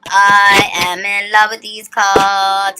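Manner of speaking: long drawn-out, swooning words; gushing. Real, not acted. Reader raving to viewers about card artwork, gushing. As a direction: stretch every word out in adoration. Gushing over her new cards.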